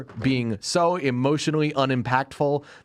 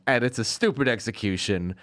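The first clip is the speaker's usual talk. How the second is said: ordinary talk, nothing marked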